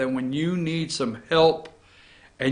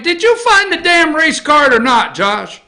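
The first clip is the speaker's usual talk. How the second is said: mock bossy demand; 8 semitones higher, 4 dB louder than usual. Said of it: "I like to say it like".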